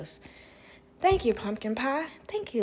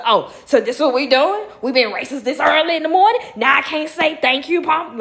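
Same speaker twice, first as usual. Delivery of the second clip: louder and higher, offended sass; sassy and offended, building up; indignant inner voice; 5 semitones higher, 9 dB louder than usual. Retold or imitated. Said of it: "my mind had went to".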